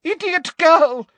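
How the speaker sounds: high, loud burst then scolding; shrieked impatience easing into high scolding; exasperated shout. A narrator's reading. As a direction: berate the girl in a high shout. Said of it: "shouted Umbridge".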